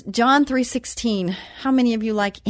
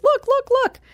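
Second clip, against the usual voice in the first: much higher, eager imitation of her dance partner; Mikey showing off; 5 dB louder, slower than usual. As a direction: squeak it out like a proud little boy. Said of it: "And he goes".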